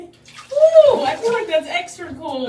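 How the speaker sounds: loud high shriek; cold shock shriek. Real, not acted. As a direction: yelp from the freezing water. Second bucket of ice water.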